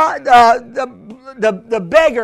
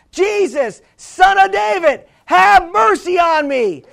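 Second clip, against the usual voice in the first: imitated insistent plea, raised pitch; louder crying out; 4 semitones higher than usual. Blind beggar refuses to be silenced, crying out more insistently. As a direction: cry it out higher and more forcefully. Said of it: "but he said louder".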